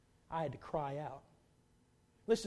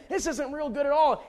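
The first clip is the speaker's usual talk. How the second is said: ordinary delivery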